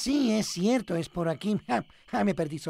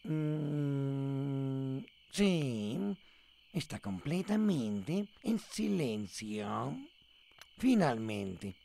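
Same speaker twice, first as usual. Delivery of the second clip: sleepy murmur; 7 semitones lower, 6 dB quieter, much slower than usual. Acted. Drowsy character settling to sleep.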